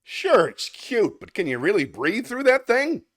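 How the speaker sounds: teasing the naked man as the elephant; a hint of a jokey elephant. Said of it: "What did the elephant say".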